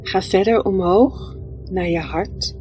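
ordinary talk

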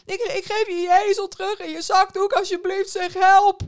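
loud, high, stammered begging; wailing plea. A narrator's reading. Innkeeper offering donkey and cloth back, wailing. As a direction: beg desperately, building to a shout. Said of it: "kermde de herbergier".

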